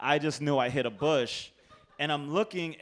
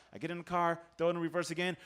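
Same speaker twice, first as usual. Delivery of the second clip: normal talking voice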